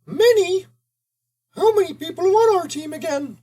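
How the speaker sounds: high cartoon mouse; squeaky mouse mutter; Mickey voice muttering. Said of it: "Mickey muttered through the mush".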